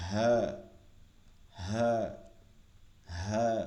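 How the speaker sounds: হ্যাঁ short, deep and solid from the chest; হ্যাঁ punched low with no breath; deep round ha. Shown as a demonstration. Teacher drilling the deep chest হ্যাঁ for students. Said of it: "গম্ভীর আওয়াজে".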